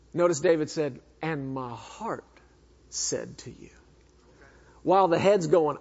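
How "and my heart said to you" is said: hushed quote. Acted. Quoting David's heart.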